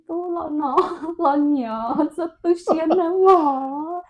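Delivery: high, slow, loud coaxing call; drawn-out high-pitched coaxing; coaxing mother. Retold or imitated. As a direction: act out calling a child in a lilting voice. Calling son in another tongue.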